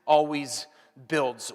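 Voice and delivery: slow, stretched "Kindness always builds"; slow emphatic. Shown as a demonstration. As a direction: say it slowly, word by word. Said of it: "you can just say that".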